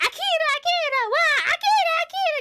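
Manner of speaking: high, excited laughing cry; real excited calling. Real, not acted. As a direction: call the name out through laughter.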